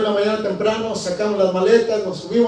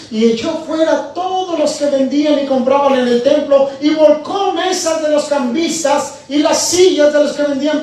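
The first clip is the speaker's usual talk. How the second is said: rising, louder preaching read; scripture reading; 5 semitones higher, 5 dB louder than usual. Acted. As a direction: proclaim the verses with building force. Reads scripture aloud. (lectura bíblica proclamada, cada vez más fuerte)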